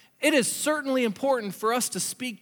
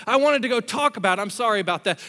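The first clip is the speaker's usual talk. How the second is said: usual speaking voice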